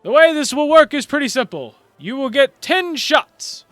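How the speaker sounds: loud, grand tournament announcer voice; booming announcer. Acted. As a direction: proclaim it to the whole crowd.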